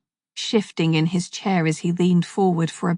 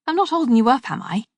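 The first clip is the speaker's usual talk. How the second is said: higher Scouse voice, politely checking; a hint of Liverpool, easygoing question; mild Scouse accent; 5 semitones higher, faster than usual. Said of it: "spoke in a Scouse accent".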